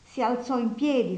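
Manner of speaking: usual speaking voice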